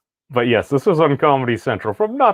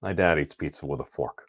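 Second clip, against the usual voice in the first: softer, sheepish admission; quiet realization; 7 semitones lower, 8 dB quieter than usual. Retold or imitated. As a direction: hush as it dawns on you. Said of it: "I sit back and I'm like".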